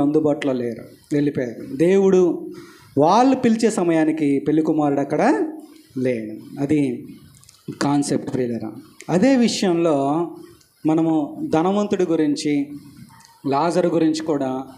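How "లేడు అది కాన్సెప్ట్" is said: normal talking voice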